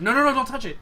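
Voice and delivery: high, loud panicked "no, no, no"; urgent shout. Acted. Seamstress yelling at a customer reaching for her machine, high urgent shout. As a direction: yell at them to keep their hands off.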